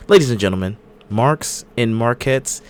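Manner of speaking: slow, drawn-out ring announcer. Acted. Show opening announcement.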